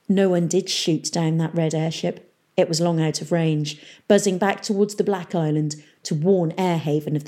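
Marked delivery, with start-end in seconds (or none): none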